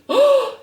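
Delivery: sharp delighted gasp, high; storyteller's excited gasp. A narrator's reading.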